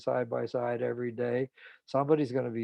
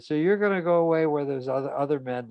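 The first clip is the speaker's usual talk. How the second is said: louder, fast, retold jealous protest; jealous outburst; 4 semitones higher, 7 dB louder than usual. Husband objecting to his wife's summer digs, jealous and loud. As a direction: blurt it out as the wounded husband.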